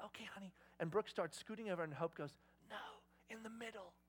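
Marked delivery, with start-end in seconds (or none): 0.1-0.5 very soft, imitating a mother at bedtime; hushed mom. Mom to daughter beside the sleeping baby, hushed agreement. 2.7-3.9 very soft imitation of a stubborn kid; insist, barely above a whisper; hushed child's insistence. Daughter to mom about getting in bed, hushed insistence.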